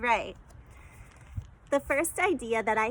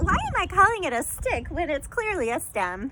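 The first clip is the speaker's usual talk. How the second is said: surprised; 6 semitones higher, 3 dB louder than usual. Retold or imitated. Self-mocking inner question.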